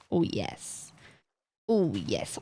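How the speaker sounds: very low, savouring voice; deep, satisfied aside; low relish. Pretend. Savouring the look of the build.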